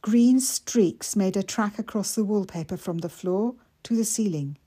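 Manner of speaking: usual speaking voice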